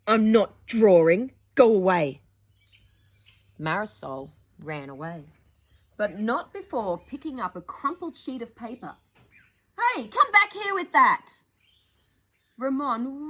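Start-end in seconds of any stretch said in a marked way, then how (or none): 0.1-2.2 angry sneer. Acted. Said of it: "Ramon sneered". 9.8-11.4 high loud shout after her; angry shout. Acted. Chasing sister with his drawing.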